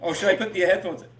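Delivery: loud call to another room; yelling across the room. Real, not acted. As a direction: ask it loudly from far off. Calling back across the house.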